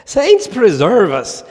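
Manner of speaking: hammed-up Irish pub caller declaring war; attempted Irish accent. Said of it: "needs an Irish accent".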